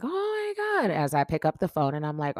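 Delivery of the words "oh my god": mock scream. Friend calling in a panic, imitated high squeal. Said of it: "scream like".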